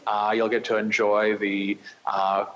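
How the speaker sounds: ordinary talk, nothing marked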